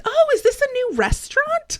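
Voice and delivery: high-pitched, innocent surprise, acted out; imitated delighted question. Imitating man with Alzheimer's arriving at care home, delighted surprise. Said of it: "his first thing he says".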